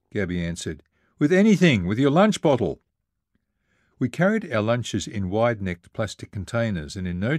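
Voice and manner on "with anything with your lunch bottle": high, loud urgent order; frantic raised-voice instruction; shouted answer. A narrator's reading. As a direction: shout back impatiently over the sea. Narrator yelling orders in sinking canoe.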